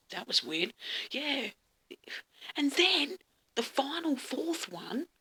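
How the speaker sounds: ordinary delivery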